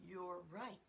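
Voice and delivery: plain reading voice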